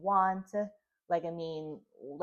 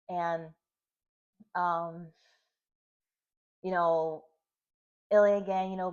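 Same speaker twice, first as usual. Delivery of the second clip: ordinary talk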